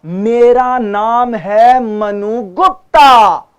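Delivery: slow, loud, every syllable crisp; clear articulation. Shown as a demonstration. Teacher showing students clear speech, slow and loud. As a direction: spell out each sound very slowly. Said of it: "articulation".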